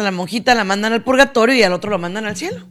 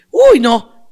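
loud, higher mock disapproval; judgmental dismissal; 4 semitones higher, 7 dB louder than usual. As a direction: recoil like a scandalised busybody. Mimicking a judgmental person.

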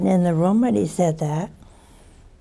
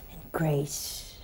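whispered, fading tenderly; hushed and tender; soft whisper; 5 semitones lower, 15 dB quieter than usual. Real, not acted. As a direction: let it fall to a whisper. Tender close to the verse.

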